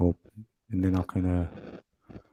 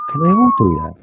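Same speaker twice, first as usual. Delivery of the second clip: very high, louder squeak of wonder; squeaky wondering thought; 6 dB louder than usual. Retold or imitated. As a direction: pop the question up into a high squeak. Inner thought about birds, squeaked.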